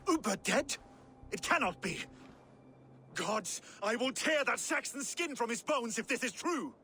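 shocked fury. Acted. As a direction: erupt in grief-struck anger. Guthrum hears brother is dead.

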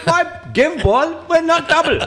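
slow, high put-on foreign accent; slow foreign accent, broken English. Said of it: "I admire your Indian accent".

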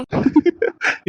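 short breathy laugh. Real, not acted. Husband laughs off his wife's accusation with a short breathy laugh. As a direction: laugh it off at her.

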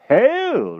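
very high, loud eager greeting; anxious to impress. A narrator's reading. King returns magician's greeting, anxious to impress. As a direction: overdo it loudly to make a good impression. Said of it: "anxious to make a good impression".

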